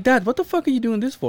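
half-joking exasperation at dad; mock exasperated. Son questions his father, mock exasperated.

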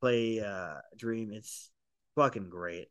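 ordinary talk

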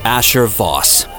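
normal talking voice

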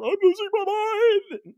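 high, slow hammy wail; hammy panic. Retold or imitated. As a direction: overact a man losing it. Mocking actor chewing scenery.

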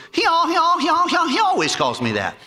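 hammed-up stutter of the waiter. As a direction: stammer out the punchline high.

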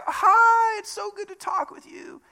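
very high, gushing put-on mom voice; hammed-up loving squeal, starting loud and softening. Loving mom greeting you on the phone, put-on mom voice. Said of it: "That was more a mom voice".

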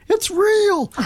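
very high, drawn-out cry; mock awed squeal. Visitor seeing players come out of the corn, high mock-awed squeal. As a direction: squeal it like an overwhelmed believer.

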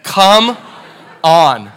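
loud, each word set apart; emphatic, loud disbelief with a big pause between; amazed exclamation. Real, not acted. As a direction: punch out two separate words in amazement. Amazed reaction to the photo.

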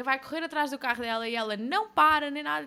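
usual speaking voice

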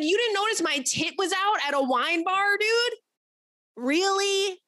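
mock-outraged retort to the date; incredulous outrage. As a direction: demand it in shock. Confronting the oblivious date.

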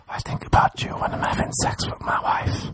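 breathy secret whisper. Pretend. Texting the girlfriend, soft whisper.

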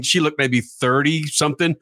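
usual speaking voice

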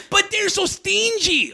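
high, slow mock woman's voice; squeaky, drawn-out heckler impression; heckling woman. Comic telling a club story, mimicking a heckler in a high slow voice. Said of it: "a woman goes".

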